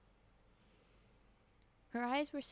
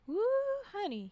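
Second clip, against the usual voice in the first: lightly whooped Louisiana drawl; amused whoop in a Louisiana accent; 10 semitones higher, 7 dB louder than usual. A narrator's reading. Husband enjoying his wife's struggle, Louisiana drawl. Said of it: "he laughed".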